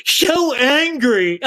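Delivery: mock whiny voice, high and very slow; mocking Gary. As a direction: drawl it out to make fun of him. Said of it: "He goes".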